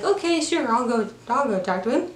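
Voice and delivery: naive and trusting, pitched high; Paddington. Retold or imitated. Naive bear agreeing to talk to the chef, trusting. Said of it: "very naive and trusting".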